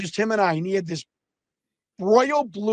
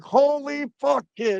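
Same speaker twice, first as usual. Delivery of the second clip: slow, higher-pitched mock awe; astonished wrestler, retold; imitated amazement; 5 semitones higher, slower than usual. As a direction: stretch it out in disbelief. Retelling DiBiase's amazed reaction.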